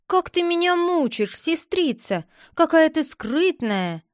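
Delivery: high-pitched, pleading reproach; impatient complaint. Acted. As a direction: whine a little at a secretive sister. Boy complains sister keeps secret.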